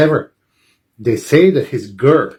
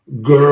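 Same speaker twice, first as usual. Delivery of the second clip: quick louder grr; short growl; 4 dB louder than usual. Acted. Fred hopes his growl comes back at the contest. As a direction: try a growl and hope.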